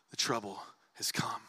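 hushed, voiceless whisper. Acted. Preacher replaying the brothers' guilty words, whispered. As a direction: breathe the words out with no voice.

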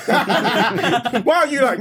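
slow, laughing mock question. Real, not acted. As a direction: laugh through the words. Mocking a friend, laughing.

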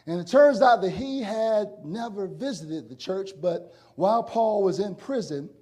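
normal talking voice

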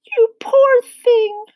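very high, slow, drawn-out pitying voice; murmuring Miss Spider. A narrator's reading. Pitying the stuck centipede, murmured in a high voice. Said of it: "she murmured".

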